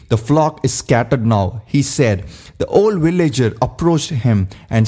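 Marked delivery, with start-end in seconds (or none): none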